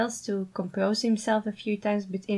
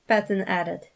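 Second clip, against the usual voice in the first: ordinary delivery, nothing marked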